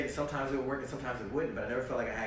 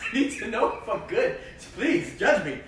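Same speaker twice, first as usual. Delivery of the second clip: laughing plea; 9 semitones higher, 11 dB louder than usual. Retold or imitated. Begging interviewer to let him act.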